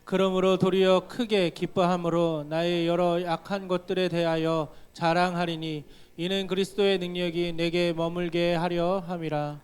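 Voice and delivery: normal talking voice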